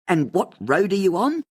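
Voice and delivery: radio host quizzing a caller on air; upbeat radio host. A narrator's reading. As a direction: keep the caller talking, chirpy. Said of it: "turned on her upbeat radio personality".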